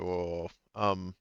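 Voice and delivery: ordinary talk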